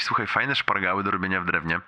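ordinary delivery

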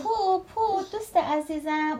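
high-pitched call, slowing at the end; acted cry without full volume; story shout. A narrator's reading. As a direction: call out urgently to a friend. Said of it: "خب کوچولو داد زد".

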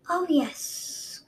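queen taunting Kestrel with malicious glee; maliciously. A narrator's reading. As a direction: savor the cruelty. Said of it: "Queen Scarlet said maliciously".

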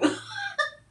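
high, loud squealing laugh; burst of laughter. Real, not acted. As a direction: crack up helplessly. Cracks up at her own point.

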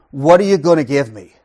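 very loud mock demand. Preacher mimicking Abraham's reply to God, loudly demanding.

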